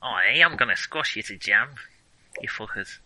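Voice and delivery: mock threat in a lightly hammed Manchester accent; Manchester-tinged accent. Player threatening to squash foes, botched Manchester-sounding character accent. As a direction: let Manchester creep into the character voice. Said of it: "a little too much Manchester about it".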